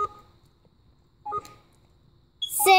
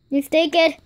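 ordinary talk, nothing marked

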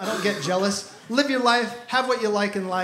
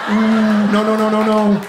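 shouting no over and over, louder; panicked loud refusals; 4 dB louder than usual. Pretend. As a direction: wave them off loudly. Sees someone walk into bathroom.

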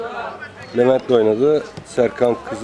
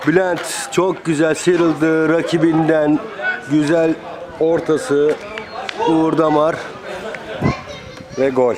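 excited commentator; 5 semitones higher than usual. Real, not acted. Replaying the goal, still excited.

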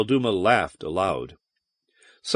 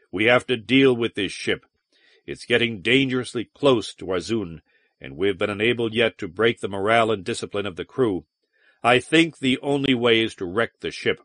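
plain reading voice

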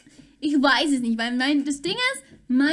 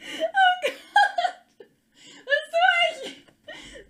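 laughing; 12 semitones higher, much slower than usual. Real, not acted. Laughing at clumsy VR pose.

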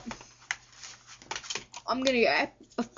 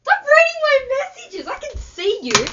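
high-pitched loud shout; yelling; 8 dB louder than usual. Real, not acted. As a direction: scream it at her. Same complaint yelled the second time.

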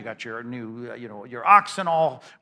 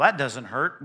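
ordinary talk

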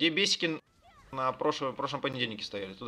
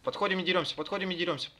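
ordinary talk, nothing marked

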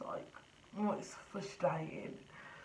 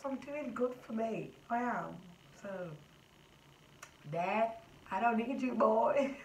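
defiant to dad; 4 semitones higher than usual. Real, not acted. Defiant message to her dad.

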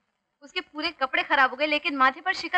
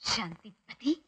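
mocking whisper; 6 semitones lower, 10 dB quieter, much slower than usual. Mocking whisper of his nickname.

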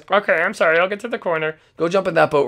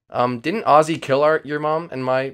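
normal talking voice

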